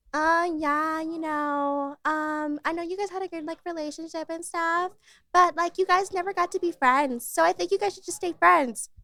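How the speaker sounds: lightly voiced girl giving advice; mimicking girl.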